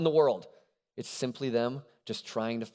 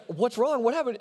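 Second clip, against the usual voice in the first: worried dad; 11 semitones higher than usual. Retold or imitated. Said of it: "he's like".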